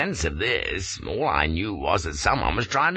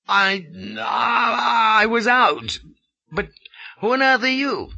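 shaky, higher stammering fright; frightened stammer; 4 dB louder than usual. A narrator's reading. Shocked Englishman stammering at his rescuer, afraid. My chattering teeth.

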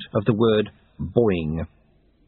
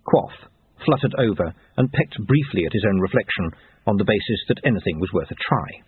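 plain reading voice

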